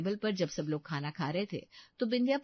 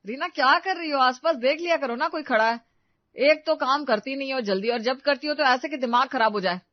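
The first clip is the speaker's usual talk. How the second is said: loud, raised-pitch telling-off; annoyed scolding; 4 semitones higher, 7 dB louder than usual. Acted. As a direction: scold her in exasperation, pushing the volume. Annoyed husband scolds careless Reena. (झल्लाकर ज़ोर से डाँटना)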